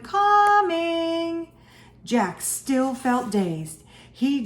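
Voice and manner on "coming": high, drawn-out singsong shout; high answering call, first syllable held; shouted call back. A narrator's reading. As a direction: sing it out long to someone far off.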